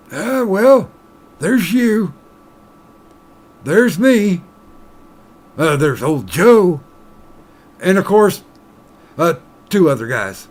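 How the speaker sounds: put-on high, loud, slow old-timer bartender. Bartender telling the miner about old Joe, high and slow. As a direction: drawl it out like an old barkeep. Joke, bartender lists who knows.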